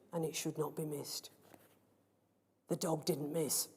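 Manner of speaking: soft unvoiced whisper. A narrator's reading. Narrator hushing the key moment, whispered. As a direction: breathe it as a secret.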